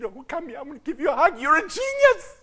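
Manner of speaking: high, loud delighted gush; overjoyed mother thanking the pastor, high and loud; excited mom. Retold or imitated. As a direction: mimic the thrilled single mom. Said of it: "She said".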